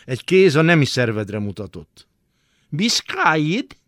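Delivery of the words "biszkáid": slow, high drawn-out question. Acted. Bath attendant questioning the boy, slow rising tone. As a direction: ask it slowly, rising at the end. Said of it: "kérdezte a kéz tulajdonosa".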